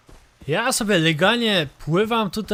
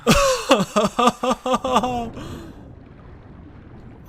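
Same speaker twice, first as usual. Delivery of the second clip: relieved laughter; 8 semitones higher than usual. Real, not acted. After surviving the attack.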